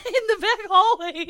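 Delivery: high, loud, breaking into laughter; loud laughing mock confession; laughing retelling. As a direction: crack up while quoting it.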